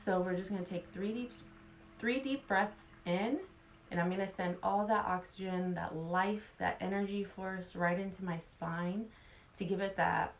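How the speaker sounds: calm guide easing tension away; guided meditation. Acted. Guided body relaxation meditation.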